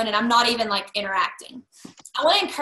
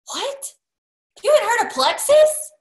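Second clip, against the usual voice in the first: higher, louder hammed-up shock; mock disbelief; 11 semitones higher, 5 dB louder than usual. To a stranger who hasn't heard of Plexus, hammed-up shock. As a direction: act stunned they've never heard of it. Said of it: "I act like they are the last people to the party".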